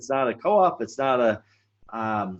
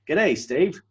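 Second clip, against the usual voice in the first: hammed-up Aussie greeting, pitched higher; higher, jokey mock-Australian accent; 4 semitones higher than usual. Shown as a demonstration. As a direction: put on a playful Australian accent. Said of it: "the end of my Australian accent".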